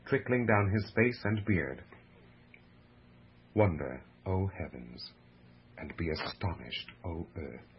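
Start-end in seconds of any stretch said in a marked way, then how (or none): 3.5-7.7 quiet, weighty lament with long pauses; hushed awe. A narrator's reading. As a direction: let it sink to a grave hush. Solemn apostrophe by the narrator.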